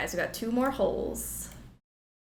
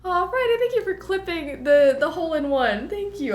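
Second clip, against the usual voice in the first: high, loud delighted thanks; excited thanks; 9 semitones higher, 7 dB louder than usual. Real, not acted. Streamer thanking Friday for clipping the shot, delighted. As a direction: gush your thanks.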